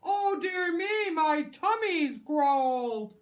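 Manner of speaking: slow whiny pup howl; howling wolf pup. Acted. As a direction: howl it out hungry. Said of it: "a wolf pup howled".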